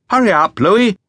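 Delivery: calling out. Retold or imitated. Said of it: "there was a voice in the darkness".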